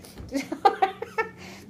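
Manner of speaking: airy high laugh with no voice; breathy laugh. Real, not acted. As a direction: laugh almost silently on the breath. Laughs after Boston reply.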